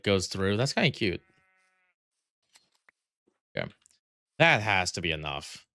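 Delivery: normal talking voice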